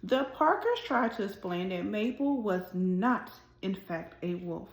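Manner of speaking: gentle, expressive storybook reading; reading to children. A narrator's reading. Teacher reading a picture book aloud to her class, expressive storytelling. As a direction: read it like story time for little kids.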